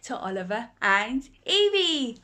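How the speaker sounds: ordinary delivery, nothing marked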